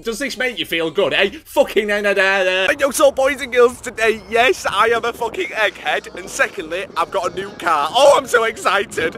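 high, loud over-the-top vlogger intro; mock-hyped YouTuber yelling; shouting at camera. Shown as a demonstration. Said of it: "just fucking shouting at the fucking camera like this".

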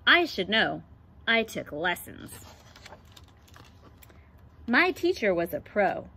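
put-on lively picture book read-aloud for kids; reading to children. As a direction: read it like storytime for the class. Reads picture book to class.

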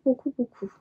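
ordinary talk

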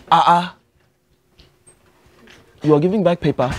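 put-on lightly held Nigerian accent, earnest student; a Nigerian student pleading with the teacher. To a geography teacher, a mild Nigerian accent. Prank persona asking for scam class.

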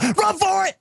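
high urgent call to flee. Acted. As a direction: yell to run. Robots flee calling out.

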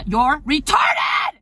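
slow, loud shout at a customer; angry shout. Acted. Sales clerk shouting at a clueless customer, loud and slow. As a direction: yell it out, furious and drawn out.

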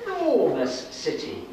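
ordinary delivery

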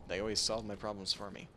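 ordinary delivery

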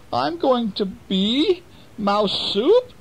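high, slow wailing mouse voice; a tiny mouse realizing its fate; frightened mouse. Acted. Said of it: "said the mouse".

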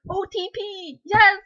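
rising to a loud, high yes; fangirl squeal. Retold or imitated. Reviewer recalls her fangirl joy, loud and high. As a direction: burst out cheering for the couple. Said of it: "And I was like".

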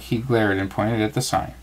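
plain reading voice

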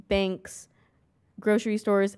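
normal talking voice

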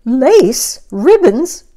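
high, loud shocked questions. Acted. Missus Rogers finding the decorated steak, high loud shock. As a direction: gasp out each word in disbelief. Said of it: "said Missus Rogers".

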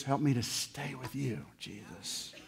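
soft, low plea trailing to breath; whispered prayer. Acted.

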